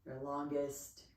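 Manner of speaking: slow, unhurried yoga breathing cues; calm meditation pacing; guided relaxation. Real, not acted.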